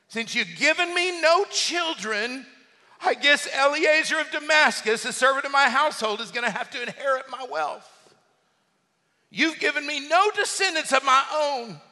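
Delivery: high-pitched, aggrieved protest; acted complaint. Acts Abraham's complaint to God.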